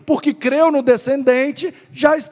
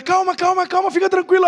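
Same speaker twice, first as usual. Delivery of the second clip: higher, hammed-up soothing nurse voice; 8 semitones higher, faster than usual. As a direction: play the fake nurse calming him down. Nurse in retold prank video. (enfermeira fingindo acalmar, voz mais aguda)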